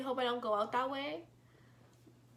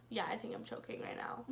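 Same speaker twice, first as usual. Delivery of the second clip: hushed, almost breathless, oddly calm; calm whisper; 7 dB quieter than usual. Retold or imitated. Telling her date she's choking, in a calm hushed whisper. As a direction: whisper it flatly like it's no big deal. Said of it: "I was so calm about it".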